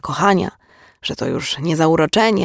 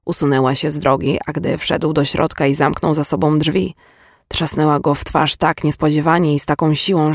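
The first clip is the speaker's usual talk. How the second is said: usual speaking voice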